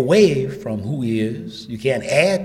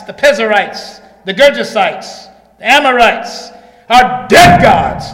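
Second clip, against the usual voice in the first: loud, raised roll call, hammering the refrain; full-force preaching; 10 semitones higher, 15 dB louder than usual. Real, not acted. Preacher denouncing other gods to the congregation, full-force preaching. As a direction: pound out each name like a verdict.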